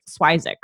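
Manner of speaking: "Swizik" with a long i, SWY-zik; "Swizik" with first syllable rhyming with eye; made-up family pronunciation. Shown as a demonstration. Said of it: "made up a pronunciation".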